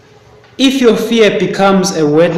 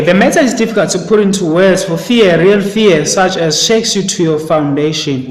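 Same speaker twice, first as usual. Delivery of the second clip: emphatic reading aloud; 2 semitones higher, 5 dB louder than usual. Acted. Teacher reads the novel's lines to the class, emphatic. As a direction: read the passage out loud and firm, pushing forward.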